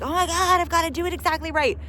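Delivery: high, loud, frantic mock panic; hyper crazy perfectionist. Mocking a panicked perfectionist listener, high frantic squeal. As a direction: play the frantic perfectionist freaking out. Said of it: "That's not to be hyper crazy".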